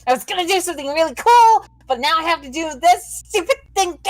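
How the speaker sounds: high, hurried, put-out complaint; mock angry. Voicing the angry-faced character's grievance to the table, mock angry. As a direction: play up an indignant sulk.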